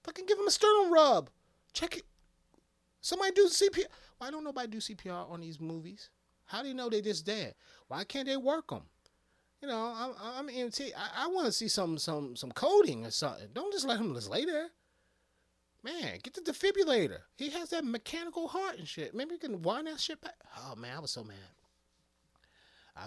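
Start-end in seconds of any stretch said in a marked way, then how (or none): 0.0-3.9 very loud, high barked orders; urgent EMT commands. Retold or imitated. EMT shouting CPR orders at the movie characters, loud. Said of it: "I wanted to tell the girl". 4.2-20.3 high, loud exasperated complaint; worked-up rant. Real, not acted. EMT fan ranting about movie CPR, exasperated.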